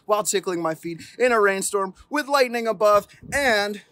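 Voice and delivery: rising storybook excitement; building excitement. A narrator's reading. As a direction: pile on the danger breathlessly. Escalating cumulative refusal.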